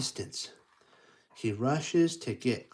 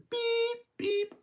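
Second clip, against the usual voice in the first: train beep. Acted.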